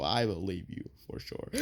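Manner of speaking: low, quiet sarcastic aside. Acted. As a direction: say it like you don't believe it. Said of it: "That is a lie".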